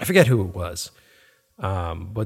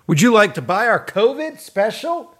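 much higher, chirpy sales pitch; mock ad-agency salesman; 11 semitones higher than usual. Ad agency selling to brands, a mock chirpy sales pitch. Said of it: "they have like a package plan".

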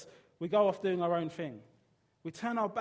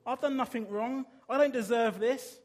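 loud pleading outcry, a touch higher; anguished cry; 5 semitones higher, 7 dB louder than usual. Acted. Jesus pleading with God from the cross, loud anguished outcry. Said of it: "He cries out".

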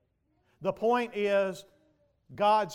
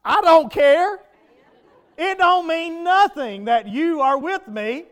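loud, higher, indignant retort; defiant outburst; 9 semitones higher, 11 dB louder than usual. Acted. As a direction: fling it back at the captain. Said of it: "You say".

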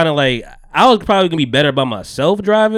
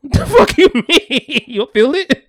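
higher, slower, laughing through the words; incredulous, cracking up; shocked laugh at her calm; laughing disbelief; 11 semitones higher, slower than usual. Retold or imitated. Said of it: "I'm like".

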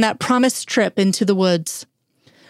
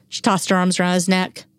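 ordinary delivery

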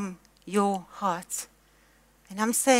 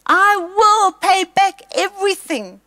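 desperate promise; 9 semitones higher, 6 dB louder than usual. Acted. Servant begging the master.

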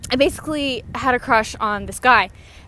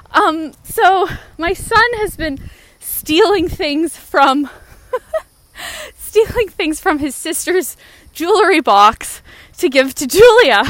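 quoting his mom; 5 semitones higher, 5 dB louder than usual. Retold or imitated. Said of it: "his mom said to my mom".

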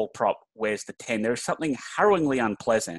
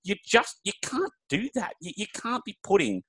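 high-pitched, incredulous objection; exasperated protest; 9 semitones higher, 3 dB quieter than usual. Real, not acted. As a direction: plead the case in a raised pitch. Mock outrage over jersey numbers.

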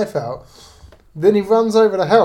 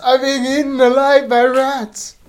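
mock screaming victim; 6 semitones higher, 3 dB louder than usual. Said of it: "he starts screaming like".

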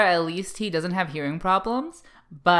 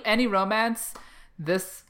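normal talking voice

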